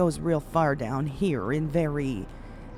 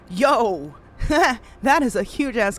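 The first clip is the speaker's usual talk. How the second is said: a loud happy yo into a high laugh; loud delighted yo and a laugh; thrilled yo; 7 semitones higher, 7 dB louder than usual. Real, not acted. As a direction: shout it, then laugh with joy. Thrilled by compliment.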